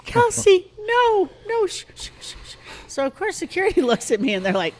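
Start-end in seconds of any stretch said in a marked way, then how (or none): 0.1-1.7 put-on high, urgent hushing of a child; pleading to make her daughter stop; frantic mom. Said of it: "And I was like". 1.7-2.6 urgent whispered shh; mother hushing her daughter in a whisper; shushing whisper. Retold or imitated. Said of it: "And I was like".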